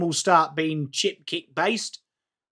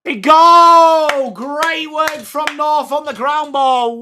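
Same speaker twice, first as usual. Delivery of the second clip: high, loud burst on the goal, then high and slower praise; excited goal call; 7 semitones higher, 5 dB louder than usual. Real, not acted. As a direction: call the goal like a commentator losing it. Commentating a goal live.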